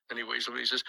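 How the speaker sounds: ordinary talk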